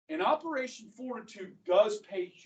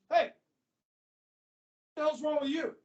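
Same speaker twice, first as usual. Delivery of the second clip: raised-pitch gruff confrontation; Patton; 6 semitones higher, much slower than usual. Retold or imitated. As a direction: challenge him, drawn out and higher. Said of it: "Patton looks at this guy and he goes".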